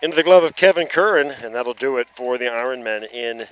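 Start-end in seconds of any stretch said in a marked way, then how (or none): 0.0-1.3 quick, louder rising call of the catch; excited call. Real, not acted. Commentator calling the final out, excited. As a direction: punch out the catch as it lands.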